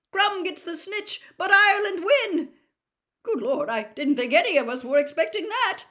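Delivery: high, loud excited announcement; amazed, full-volume sports commentary; astonished commentator. Acted. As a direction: shout the surprise result to the crowd. Stunned commentator announces surprise result.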